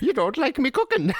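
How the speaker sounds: squeaky comic Irish imp; leprechaun. Retold or imitated. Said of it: "You made him sound like Leprechaun there".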